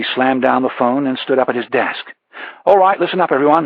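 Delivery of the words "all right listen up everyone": loud, higher call to the room; shouted. A narrator's reading. Producer shouts to the whole newsroom, loud and higher. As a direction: raise the voice to silence the newsroom. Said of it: "he shouted".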